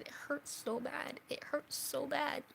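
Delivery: slow, aching plea close to crying; tearful. Retold or imitated. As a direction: relive saying it through tears. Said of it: "there's this tears running down my face".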